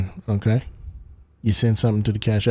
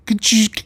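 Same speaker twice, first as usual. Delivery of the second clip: camera click sound; 8 semitones higher, 4 dB louder than usual. Pretend. Mimicking a screenshot sound.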